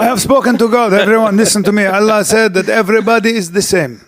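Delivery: hammed-up Lebanese prophet; mock Lebanese accent. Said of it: "a Lebanese accent".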